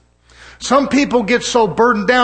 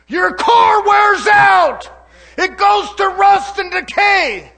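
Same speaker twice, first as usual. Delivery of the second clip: preaching hard; 9 semitones higher, much slower than usual. Real, not acted. Preacher driving point home.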